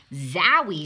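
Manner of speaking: loud startled exclamation; excited zowie. A narrator's reading. Ada hit by a stench: loud excited zowie. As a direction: burst out at the sudden stink. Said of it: "said Ada".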